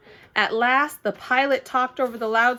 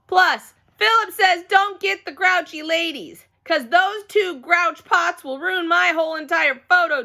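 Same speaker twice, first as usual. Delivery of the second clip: put-on loud kid; 8 semitones higher, 4 dB louder than usual.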